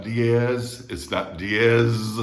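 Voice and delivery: "diez" drawn out with a buzzing z; wrong English Z. Shown as a demonstration. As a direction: stretch "diez" to end in zzz. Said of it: "just like the S in sister".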